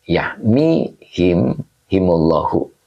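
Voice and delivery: yahmihim drawn out very slowly, syllable by syllable; slow dictation. Shown as a demonstration. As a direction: read yahmihim slowly so the class can follow. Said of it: "teacher reading the word for students".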